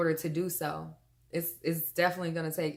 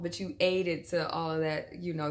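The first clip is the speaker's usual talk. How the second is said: ordinary delivery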